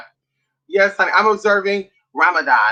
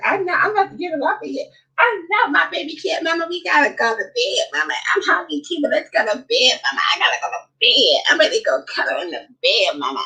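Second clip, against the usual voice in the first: put-on high, whiny little-kid voice begging to go to bed; whiny tired child; 7 semitones higher than usual. Child whining to Mama about bedtime, high and whiny. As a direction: pitch up and whine like a sleepy toddler.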